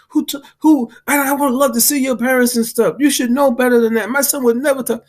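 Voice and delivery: high, indignant mom voice; imitating a scolding mother. The guy's mother scolding him for his language, high indignant mock voice. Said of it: "his mom look at me like".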